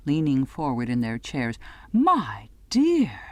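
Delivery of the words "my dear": high breathy gasp of scandalised delight; shocked gossip. A narrator's reading. A wife over tea relishing scandal, breathy and high. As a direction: savour the horror like juicy gossip. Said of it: "all horror and prurience".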